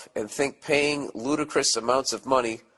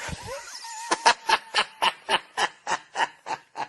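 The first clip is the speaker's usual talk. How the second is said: big high laugh. Real, not acted. Host cracking up at the letter topic, squealing laugh. Reacts to his own headline.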